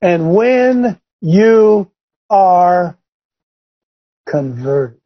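每个字都放慢加重; slow stressed phrase. Shown as a demonstration. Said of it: "Watch this".

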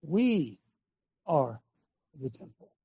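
softly, one word at a time with long pauses; real slow. Shown as a demonstration. Preacher says it again for Vinny, real slow. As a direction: spell it out slowly and quietly, word by word. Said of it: "say it again real slow".

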